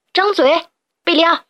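sharp shout; command. Acted. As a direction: order him to open up. Hero commands sheep.

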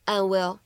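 "and will" linked, the d in "and" held back unreleased; incomplete plosion. Shown as a demonstration. Teacher shows learners a linking trick, "and will" with unreleased d. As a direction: run "and will" together without releasing the d. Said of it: "类似于不完全爆破的处理".

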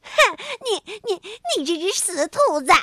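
fast indignant outburst; angry. A narrator's reading. Frog furious at being fooled, fast indignant outburst. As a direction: splutter in offended anger. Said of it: "跳跳十分生气".

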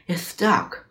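mildly dismayed outcry; crying out. A narrator's reading. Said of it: "cried the monkey".

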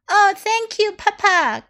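put-on high, bright thankful cry of a girl; excited cry. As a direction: thank Papa with delight. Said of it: "cried Clara".

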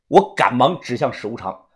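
ordinary delivery, nothing marked

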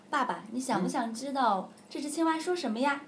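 a curious little prince asks his dad; lightly voiced eager boy; child asking. Son voiced asking father.

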